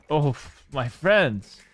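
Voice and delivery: normal talking voice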